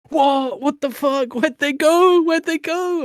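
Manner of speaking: high, loud, baffled squeal; Ruben's outburst. Retold or imitated. Blunt mate at a festival, shrieking high and confused. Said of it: "my mate Ruben ... just goes".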